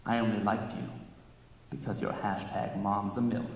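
soft, low confession; whisper. Acted. Groom tells bride a secret, low and soft. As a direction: lean in and say it softly. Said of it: "I whisper in her ear".

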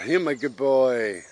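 slow, fond praise for the dog; drawn-out loving praise for a tired dog; praising the dog. Real, not acted.

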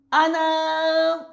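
high, stretched-out wail; drawn-out cry. Acted. Teacher acts out hearing noises in darkness, high drawn-out cry. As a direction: hold it long on one high note.

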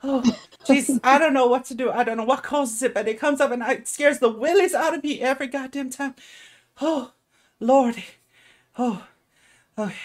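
high, fast, loud fretful woman; frazzled halfling woman. Acted. Halfling woman fretting about daily explosions, high and fast.

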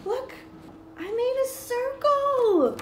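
high, slow delighted reveal; excited. Acted. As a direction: show it off with big delight. Teacher shows finished circle.

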